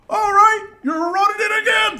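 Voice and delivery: high, loud bellow of a furious coach; coach yelling. Retold or imitated. Coach yelling at the team to run again, loud and high. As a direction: bark it across the field. Coach orders another lap.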